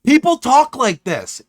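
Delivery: slow, high and loud disbelief; incredulous. Real, not acted. Reacting to how the messages read, slow and incredulous. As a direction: stretch it out, baffled. Mocking the message's odd wording.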